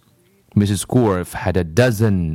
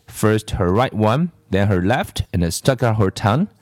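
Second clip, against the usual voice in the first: ordinary delivery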